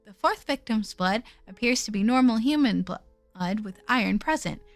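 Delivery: low, even narration voice; story narration. Acted. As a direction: read the game text calmly. Reads game narration quietly.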